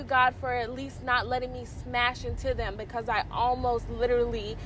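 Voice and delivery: ordinary delivery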